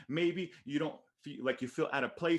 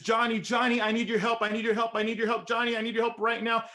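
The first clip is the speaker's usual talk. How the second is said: much higher and louder, desperate repeated pleas; panicked pleading; 9 semitones higher, 6 dB louder than usual. Retold or imitated. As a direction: beg for help, frantic and breathless. Sister's panicked call replayed.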